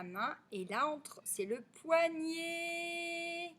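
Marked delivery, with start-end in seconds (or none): 1.6-3.5 POIGNET with a long, high final é; elongated final vowel. Shown as a demonstration. Teacher points out the sound é, "poignet" stretched at the end. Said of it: "c'est le son et".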